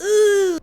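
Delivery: high, loud squirming "ooh"; disgusted ooh. Real, not acted. Reacts to the assault described.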